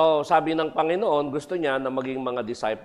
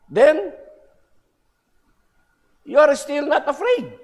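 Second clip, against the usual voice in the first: much higher and louder, baffled devil; the devil can't believe he isn't scared; exasperated Satan; 13 semitones higher, 6 dB louder, faster than usual. Acted.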